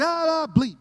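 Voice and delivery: a loud grumbling rant at the government; griping barbecue guy. Retold or imitated. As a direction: rant while flipping burgers. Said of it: "he's like".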